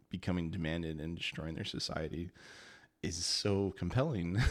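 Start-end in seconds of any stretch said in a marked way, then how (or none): none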